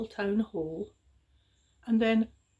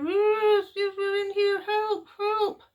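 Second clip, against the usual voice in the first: small high far-off cries; tiny voices calling for help; trapped children; 12 semitones higher, 5 dB louder, slower than usual. A narrator's reading. Children trapped behind the boulder calling, high and small.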